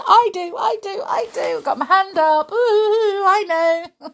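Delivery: high, loud eager I do; eager child. Pretend. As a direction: play a keen pupil with hand up. Eager child answering quiz.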